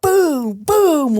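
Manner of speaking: two loud, high impact noises; shouted booms. Retold or imitated. Storyteller acting out smashing against the wall, shouted booms.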